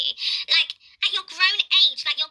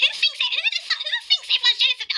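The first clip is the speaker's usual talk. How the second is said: high-pitched, incredulous challenge; indignant disbelief; 11 semitones higher than usual. Real, not acted. Answering a critic who claimed she thinks everyone's jealous, indignant. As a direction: fire back in high, exasperated disbelief.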